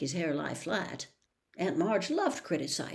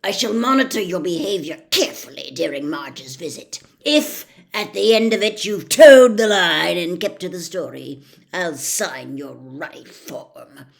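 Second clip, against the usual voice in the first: louder, threatening uncle laying down terms; menacing Uncle Vernon; 12 dB louder than usual. Acted. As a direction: growl the conditions, swelling then slowing.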